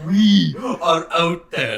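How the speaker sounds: slow, loud, solemn parody; mock-grand declaration, each word stretched out; mocking Alex. Friend imitates Alex speaking for the brotherhood, mock-solemn. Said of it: "Alex is like".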